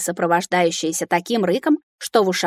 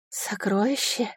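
dragon repeating; 8 dB quieter, slower than usual. A narrator's reading. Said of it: "повторила эта чешуйчатая мамаша".

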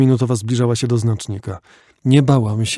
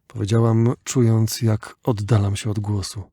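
ordinary delivery

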